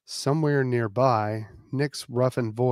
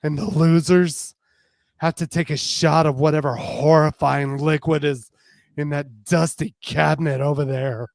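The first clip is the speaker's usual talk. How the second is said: playful threat; 4 semitones higher, 7 dB louder than usual. Acted. Justine continuing the dare.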